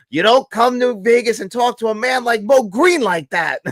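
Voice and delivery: high, loud movie-quote outrage; mock indignant gangster. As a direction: protest loudly like an offended mob character. Acting out a Godfather line.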